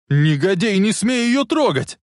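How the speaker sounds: high, loud outraged yell; furious shout. Acted. Marty defending his mother from Biff, furious shout. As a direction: shout him away from her, drawing out the end.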